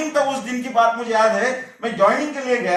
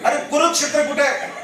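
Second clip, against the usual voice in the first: higher, impatient repeat of the question; mock irritation; 6 semitones higher, 4 dB louder than usual. Angry interviewer repeats his question to a staring candidate, mock irritated. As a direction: imitate an annoyed interviewer, pitched up. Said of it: "गुस्से से देख रहा है".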